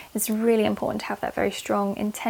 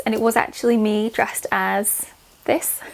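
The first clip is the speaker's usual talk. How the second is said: ordinary delivery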